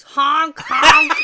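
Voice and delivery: loud honking goose; goose honk impression. Shown as a demonstration. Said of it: "it's like a goose".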